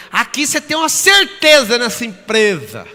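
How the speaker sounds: loud, high put-on voice of another colleague; mocking coworker. Another coworker insisting on job security, loud mock voice. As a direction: ham up the other coworker. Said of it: "Aí o outro".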